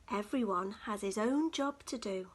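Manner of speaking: ordinary delivery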